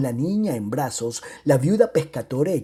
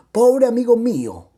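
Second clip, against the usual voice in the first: exclaimed pity; 8 semitones higher, 6 dB louder than usual. Acted. Pitying poor Pomino.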